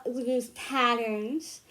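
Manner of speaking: normal talking voice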